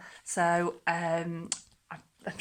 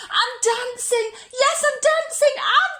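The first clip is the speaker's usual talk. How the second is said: much higher, louder delighted shout; joyful cry; 8 dB louder, slower than usual. Acted. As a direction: burst out with pure joy. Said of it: "Gerald cried".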